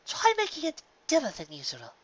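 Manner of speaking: high, refined lady voice; fussy Rarity. Acted. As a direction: fret about a missing friend.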